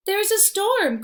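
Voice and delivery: high, alarmed cry; hare crying out. Acted. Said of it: "cries Hare".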